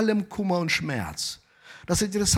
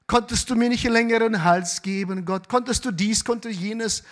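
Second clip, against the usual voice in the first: higher-pitched pleading grumble to God; playfully aggrieved, pitched up; mock complaint; 6 semitones higher than usual. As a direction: pester God with a list of wishes. Acted complaint to God about height.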